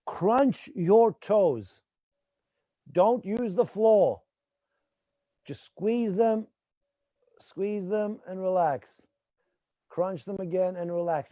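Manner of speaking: drawn-out, unhurried instructions; slow guiding voice. Shown as a demonstration. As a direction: take each word slowly. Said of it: "slow exercise instructions".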